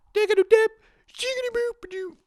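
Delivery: very high squeaky scat; silly nonsense noises. Pretend. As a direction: squeak out playful filler syllables. Silly nonsense between bits.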